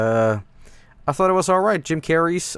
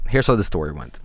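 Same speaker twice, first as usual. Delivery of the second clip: repeated much lower in pitch; low dramatic repeat; 9 semitones lower than usual. Shown as a demonstration. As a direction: drop low for a mock-ominous story opener. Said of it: "So here's how the story went".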